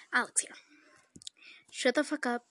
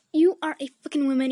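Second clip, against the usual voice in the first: louder mock angry dad; imitating dad yelling; 3 semitones higher, 7 dB louder than usual. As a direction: bark it like the furious father. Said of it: "my dad was like".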